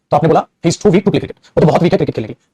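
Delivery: ordinary delivery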